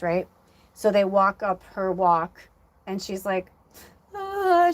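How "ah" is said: high pained cry, imitating the mother; a sudden high wail as the news hits; crying gasp. Mother breaking down at the door.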